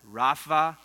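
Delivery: "Raphael" slowly, with a gap before El. Shown as a demonstration. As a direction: say "Raphael" in two separate parts, Rapha and El. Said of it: "Let me say that name slowly".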